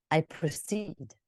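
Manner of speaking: slowed, deliberate; slow storyteller line. Shown as a demonstration. Coach modelling a story transition, slow. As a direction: draw it out as a story beat.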